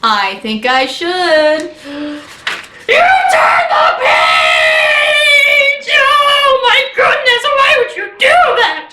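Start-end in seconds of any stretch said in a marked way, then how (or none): 1.1-8.9 high, drawn-out horrified shout; Grover, aghast. Acted. Grover sees the page was turned, aghast shouting. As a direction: wail it slowly in cartoon outrage. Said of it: "he's shouting stars!".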